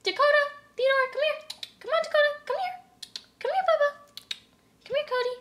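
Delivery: very high, eager pet call; squeaky-high coaxing to come over; calling pets by name. Shown as a demonstration. Owner calling guinea pigs on camera, high coaxing calls. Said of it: "show you an example of me calling them by name".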